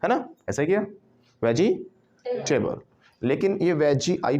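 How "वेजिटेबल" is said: वेजिटेबल split slowly as veji, table; wrong syllable break. Shown as a demonstration. Teacher mimics students' wrong break, वेजिटेबल as veji-table. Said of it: "वेजी अलग से तोड़ लिया".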